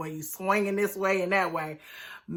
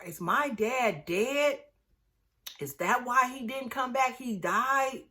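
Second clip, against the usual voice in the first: put-on slow, soft little-girl question, rising; lightly voiced child, slowly; small child asking; 4 semitones higher, 4 dB quieter, slower than usual. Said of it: "Asking her".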